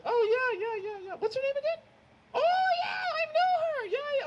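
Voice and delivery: high, loud knowing mom voice; mock mom. Mom to her son about the girl, high mock gushing. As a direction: gush it like an eager mom.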